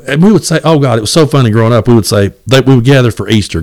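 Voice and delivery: ordinary talk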